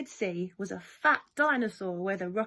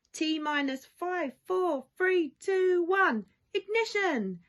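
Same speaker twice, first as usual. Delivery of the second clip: countdown climbing higher and louder to ignition; excited countdown; 8 semitones higher, 3 dB louder, slower than usual. Acted. Reading the rocket launch to children, a rising excited countdown.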